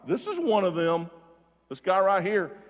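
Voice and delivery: single him out loudly to bystanders; pointed accusation. Retold or imitated. Servant girl accusing Peter to bystanders.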